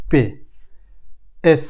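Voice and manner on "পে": পে, not English পি, said as the German P; German letter sound. Shown as a demonstration. Teacher contrasting পি with German পে. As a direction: repeat পে slowly for students. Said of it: "আমরা বলি পি, এখানে হচ্ছে হেকার".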